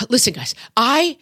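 hammed-up exhausted mom venting; frazzled mom. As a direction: play the frazzled mom who is done. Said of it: "I think this is a very mom thing".

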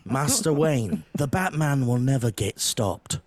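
British butler. Retold or imitated. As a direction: reassure master Wayne like a loyal butler. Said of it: "is that better?".

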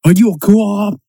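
high, loud bad Boston accent. Friend acting out The Departed beating scene, bad Boston accent. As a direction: bark out a hammed-up Boston accent. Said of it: "with that bad Boston accent".

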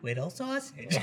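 hushed mimicked echo; imitated reply. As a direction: repeat "Whittle Sausage" quietly, in a put-on voice. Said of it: "and Lindsay goes".

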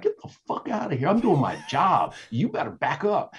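high-pitched mock yell at moderate volume; heated retort, replayed for a listener; retold screaming comeback. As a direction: act out shouting back, held in. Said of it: "we're screaming at each other".

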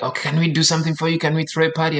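eager mother. Retold or imitated. Mom asking departing son for a party, eager. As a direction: lightly imitate a mom pleading to celebrate.

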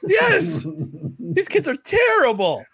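high, slow mock disgust. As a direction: sneer it out, drawn out.

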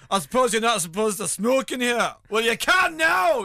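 high, loud mock bluster; imitated defiant smoker. Actor defying a smoking ban in Angels, imitated loud defiance. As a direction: act out a star loudly overruling the smoking ban. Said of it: "he says like that".

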